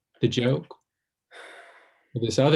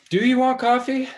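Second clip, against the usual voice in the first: forced bright offer; 13 semitones higher, 5 dB louder than usual. Acted. Awkward deflecting offer mid-argument.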